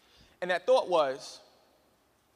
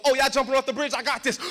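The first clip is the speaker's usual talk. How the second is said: breezy, sing-song friend quote, louder; casual crazy friend; 5 semitones higher, 6 dB louder than usual. Retold or imitated. Friend at the bridge, carefree. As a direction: toss it off like nothing scares you.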